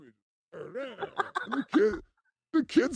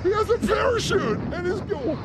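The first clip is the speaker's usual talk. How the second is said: excited; 11 semitones higher, 4 dB louder than usual. Real, not acted. Reacting to skier video.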